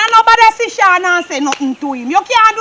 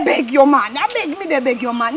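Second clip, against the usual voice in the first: hushed plea; 7 semitones lower, 7 dB quieter than usual. Acted. Begging partner not to cause trouble.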